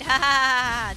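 loud real laugh bursting out; loud laughter. Real, not acted. Laughing at the thing dying.

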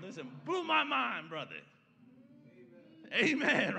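loud, high climax of the sermon; rising pulpit shout, laughing into it; preaching at full force. Acted. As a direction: shout it to the congregation.

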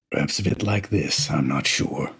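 deep, menacing dark lord rumble; Sith lord voice. Pretend. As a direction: growl it like a commanding Sith. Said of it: "what would Darth Jedi Sith Eye Bob sound like".

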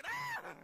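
sudden high-pitched scream; high shriek. Acted. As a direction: shriek in alarm. Shrieks at the song.

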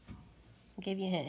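ordinary talk, nothing marked

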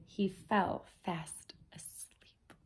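slow, soft whisper trailing off; sleepy whisper. A narrator's reading. Reader ends the bedtime story, slow drowsy whisper. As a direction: whisper it like lulling a child to sleep.

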